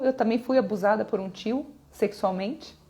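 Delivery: usual speaking voice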